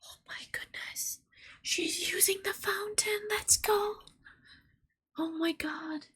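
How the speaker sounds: hushed, high whispered squeal; real thrilled hush; excited whisper. Real, not acted. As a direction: whisper in delight so the kitty isn't startled. Excited hush watching cat use fountain.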